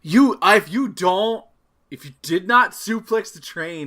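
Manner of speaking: mock threat. Mock threat about suplex.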